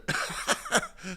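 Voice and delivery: high breathy laugh. Real, not acted. Laughs at chat comment.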